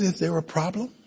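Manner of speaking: a hint of a calm phone voice; calm operator voice. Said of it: "A voice said".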